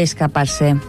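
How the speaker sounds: ordinary talk, nothing marked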